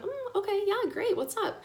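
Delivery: high, airy okay; light and unbothered before the bad news; mock casual. Mother answers the geneticist lightly, high and airy.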